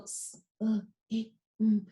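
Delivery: short isolated sounds with gaps; separate sounds. Shown as a demonstration. Teacher showing one way to read the word, sound by sound. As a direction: sound out each letter on its own. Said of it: "I could go".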